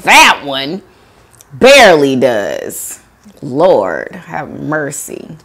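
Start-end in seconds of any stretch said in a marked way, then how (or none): none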